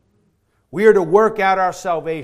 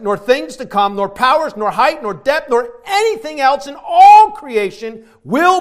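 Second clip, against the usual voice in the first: loud, higher ringing proclamation; booming sermon delivery; full-force preaching; 4 semitones higher, 8 dB louder than usual. Acted. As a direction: preach it with triumphant conviction.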